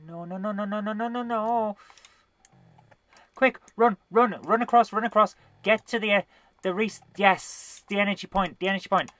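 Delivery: no no no as it catches you; being chased. Real, not acted. Chased by ghost, low energy.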